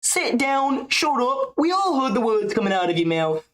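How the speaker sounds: very high mocking scold; squeaky put-on fighter voice; Ian Gary impression. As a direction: pitch way up, whining put-down. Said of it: "[name]'s Gary impression".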